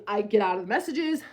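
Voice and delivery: ordinary delivery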